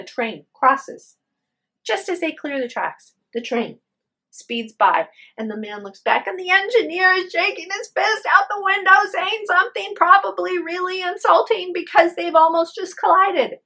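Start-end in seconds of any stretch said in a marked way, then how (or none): none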